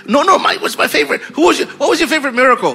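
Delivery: high, rapid-fire chatter; excited disciple. Acted. Disciples eagerly swapping favourite miracles.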